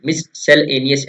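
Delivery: मिससेलेनियस slow, split as mis-sel-enious; broken into parts. Shown as a demonstration. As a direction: break मिससेलेनियस into pieces for memorising. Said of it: "ऐसे तोड़कर याद कीजिए इसको". (मिससेलेनियस को धीरे-धीरे तोड़कर, मिस-सेल-एनियस)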